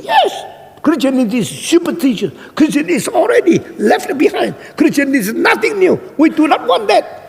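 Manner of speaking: very high, insistent angry tirade; fierce shrieking rant. Retold or imitated. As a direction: imitate a fierce woman ranting against religion. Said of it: "teriak-teriak perempuan galak".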